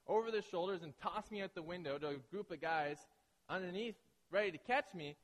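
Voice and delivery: ordinary delivery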